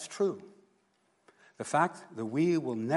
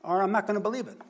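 higher, insistent demand for proof; pragmatic Thomas; 8 semitones higher, 6 dB louder, faster than usual. Retold or imitated. Preacher playing Thomas demanding facts, higher and insistent. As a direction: push hard like a blunt glass-half-empty guy. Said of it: "And he just says".

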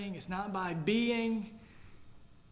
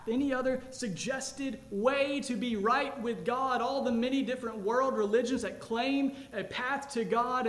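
loud, insistent pulpit voice; full-force preaching; 4 semitones higher, 4 dB louder than usual. Acted. Pastor challenging the congregation, loud preaching. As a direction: press the gospel hard on the listeners.

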